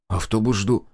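ordinary delivery, nothing marked

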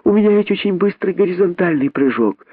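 put-on high, eager child's boast; keen high-pitched youngster; exclaiming little monkey. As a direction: insist hopefully in a small high voice. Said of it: "воскликнула мартышка-дочка".

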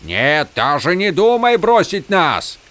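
high, slow, forceful cry; desperate protest. A narrator's reading. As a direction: forbid the god to leave. Hero rages at departing god.

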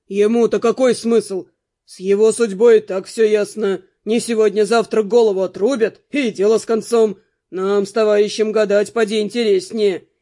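a guard bargaining for a free reading; guard's voice. A narrator's reading. Guard pressing the fortune-teller for a free reading, low and loud.

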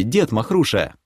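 ordinary delivery, nothing marked

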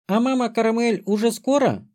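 высокий голосок маленькой девочки; спроси тоненько, как нетерпеливый ребёнок; детский голос. A narrator's reading. Маленькая девочка спрашивает отца. (детским голоском)

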